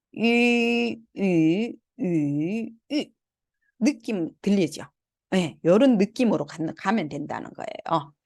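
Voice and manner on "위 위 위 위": 이 as rounded ü, level, rising, dipping, falling; four-tone ü drill. Shown as a demonstration. Teacher letting students hear ü in four tones, level, rising, dipping, falling. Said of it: "성조 사 성을 붙여서 한번 읽어볼게요".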